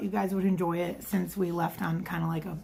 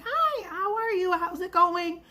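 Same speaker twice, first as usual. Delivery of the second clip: server greeting; 10 semitones higher than usual. Retold or imitated. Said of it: "And I'm like".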